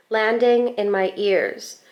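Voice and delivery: ordinary delivery, nothing marked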